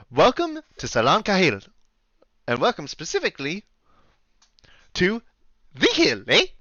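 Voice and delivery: Canadian innkeeper's welcome, rising "eh"; Canadian accent. Acted. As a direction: present the inn in a lilting Canadian accent. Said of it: "deal with the accent".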